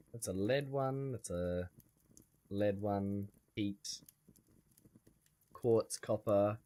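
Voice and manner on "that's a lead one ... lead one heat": ordinary delivery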